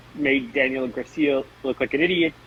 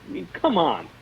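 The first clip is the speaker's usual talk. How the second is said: usual speaking voice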